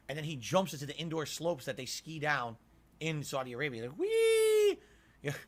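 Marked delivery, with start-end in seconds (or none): 4.0-4.8 squealed "whee" like sliding downhill; high, loud mock "whee". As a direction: let out a loud, high-pitched play squeal. Imitating a gleeful skier going downhill.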